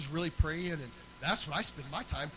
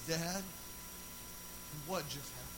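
soft, lowered, puzzled question; hushed daughter's question; 3 semitones lower, 11 dB quieter than usual. Retold or imitated. Daughter peeping out at dad after his noisy praise, hushed puzzlement. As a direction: ask it quietly, with a pause after Dad.

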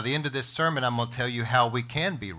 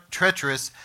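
ordinary delivery

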